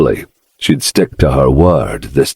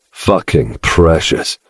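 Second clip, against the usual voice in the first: usual speaking voice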